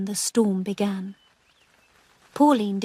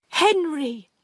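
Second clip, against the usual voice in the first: frightened; 6 semitones higher than usual. Acted. Pauline to ghost.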